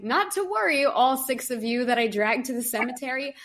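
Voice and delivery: high, bright, overconfident reassurance; cheery reassurance. Retold or imitated. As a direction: talk the group down brightly. Reassuring her group at the cemetery.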